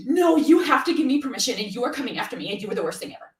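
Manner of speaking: high, fast, loud mock tirade; gasp, then rush out an overblown accusation; mock-outraged Karen outburst; Karen lashing out. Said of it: "they will lash out at the tribe".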